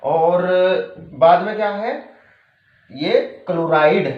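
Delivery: ordinary talk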